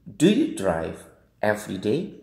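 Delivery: slow, "every day" lifting upward at the end; rising question intonation. Shown as a demonstration. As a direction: ask it slowly, pitch climbing on "day". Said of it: "Ini naik, ya, intonasinya".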